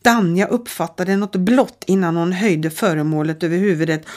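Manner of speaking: ordinary delivery, nothing marked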